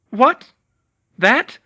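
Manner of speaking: high strained choked voice. Acted. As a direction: force it out while pinned. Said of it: "Hermione choked out".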